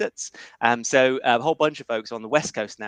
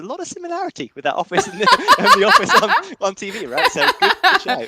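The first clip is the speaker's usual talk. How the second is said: much higher and louder, laughing through the words; 8 dB louder than usual. Real, not acted. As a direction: laugh while admitting it, genuinely amused. Laughing at office similarity.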